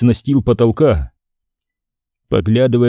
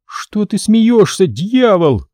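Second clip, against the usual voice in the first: trembling voice; 9 semitones higher, slower than usual. Acted. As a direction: voice trembling, near tears. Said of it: "Дрогнувшим голосом".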